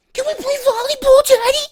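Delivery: put-on loud, very high squeaky child voice; nagging kids. Kids pestering daddy at the beach, squeaky nagging. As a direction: pester in a piercing falsetto. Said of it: "and you got the kids".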